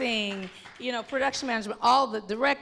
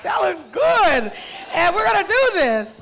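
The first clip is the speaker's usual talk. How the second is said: excited approval; 8 semitones higher, 10 dB louder than usual. Retold or imitated. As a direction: call it out with delight. Approving on set.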